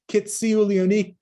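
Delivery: high, loud joyful thanks; grateful people's cry. Acted. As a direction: call out thanks to the hero, lifted high. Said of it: "they said".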